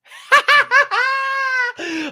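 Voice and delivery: loud, very high cackle; big high mocking laughter; exaggerated laugh. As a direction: burst into a high, hammed-up laugh. Exaggerated laugh at own sarcastic claim.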